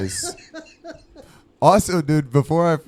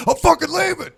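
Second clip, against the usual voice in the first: high, louder mock huff; mock storming off; 5 dB louder than usual. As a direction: squeal it like someone storming out. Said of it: "You're like".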